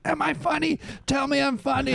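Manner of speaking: high-pitched, needy mock begging; hammed-up, very high desperate demand; desperate pleading. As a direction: beg for validation in a squeaky-high voice. Retold desperate grab for validation.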